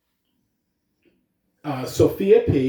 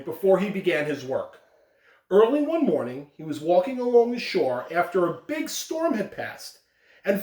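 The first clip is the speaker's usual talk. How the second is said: story narration; 5 semitones higher than usual. A narrator's reading. Principal reads parable aloud.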